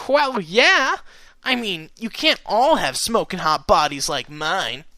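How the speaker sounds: cocky, joking boast, high at the start; slow, showy tease; amused teasing. A narrator's reading. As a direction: draw it out with playful swagger. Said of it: "sounding deeply amused".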